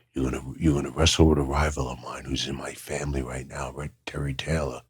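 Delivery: hushed, confiding whisper; secret whisper. Retold or imitated. Bobby Heenan confiding the rivalry plan, whispered.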